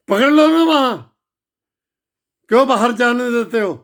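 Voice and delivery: real shout of rage; furious shout. Real, not acted. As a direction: yell at them to seize them. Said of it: "घर में बैठा आग बबूला हो रहा हूं".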